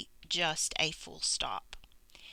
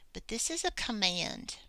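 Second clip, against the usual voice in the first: ordinary talk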